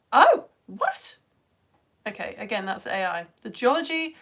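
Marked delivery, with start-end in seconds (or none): 0.1-1.2 high, loud "what?"; startled, rising exclamation; surprised. Real, not acted. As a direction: react in disbelief to what you just read. Surprised by a search result.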